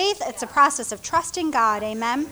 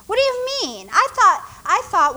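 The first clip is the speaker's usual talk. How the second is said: higher and louder, sputtering; indignant outburst; 7 semitones higher, 4 dB louder than usual. Retold or imitated. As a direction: protest as if insulted. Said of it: "Naaman was like".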